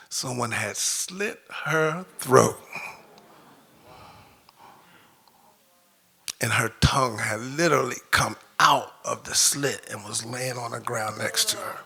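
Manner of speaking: fighting back tears, barely getting it out; choked up. Real, not acted. Telling the audience how his horse was found, choked up. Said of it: "I didn't know I was gonna get this choked up".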